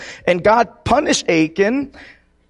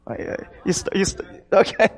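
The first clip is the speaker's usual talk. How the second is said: ordinary talk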